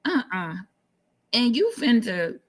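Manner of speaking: normal talking voice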